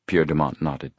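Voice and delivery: usual speaking voice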